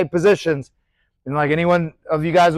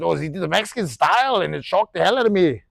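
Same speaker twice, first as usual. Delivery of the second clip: bad Irish accent slipping into English; botched Irish accent; 3 dB quieter than usual. Coach quoting a boxer's complaint, botched Irish accent. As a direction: attempt McGregor's Irish accent and miss. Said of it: "Irish accent. That was an English accent".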